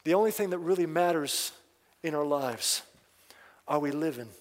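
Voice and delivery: usual speaking voice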